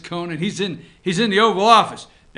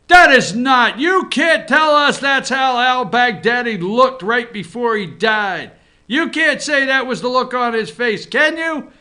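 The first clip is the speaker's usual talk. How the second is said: loud, higher-pitched outraged interrogation; indignant Adam Schiff; 3 semitones higher, 5 dB louder than usual. Pretend. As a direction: grill the witness in mock outrage, raising your voice. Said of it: "Adam Schiff's going to subpoena him and say".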